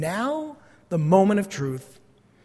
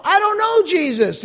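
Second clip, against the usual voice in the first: voicing Peter; 9 semitones higher than usual. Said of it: "He assures a servant girl".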